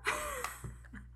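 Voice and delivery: a high, breathless burst of laughter; shrieking laugh at herself; laughter cracking into a squeal. Real, not acted.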